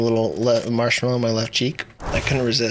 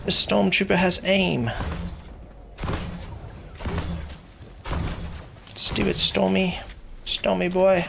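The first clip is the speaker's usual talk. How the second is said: put-on British accent, a little higher; 4 semitones higher, 5 dB quieter than usual. Streamer keeping the accent till next kill, mock British. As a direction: play a British soldier-ish accent while gaming. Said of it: "Accent till next kill".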